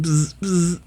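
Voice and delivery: high-pitched imitated electric buzz; freaked-out buzzing. A narrator's reading. Frost starts freaking out, high-pitched buzzing. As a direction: make two quick electric zaps, high. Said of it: "Frost starts to freak out".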